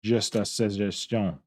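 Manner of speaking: slow, rounded Quebecois French accent on "Just a suggestion"; accent québécois, lent. Shown as a demonstration. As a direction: play a Québécois speaker tossing off a remark. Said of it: "Quebecois accent".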